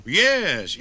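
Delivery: ordinary delivery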